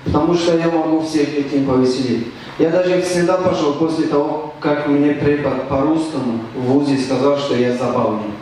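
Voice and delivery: ordinary delivery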